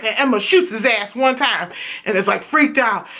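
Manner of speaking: ordinary talk, nothing marked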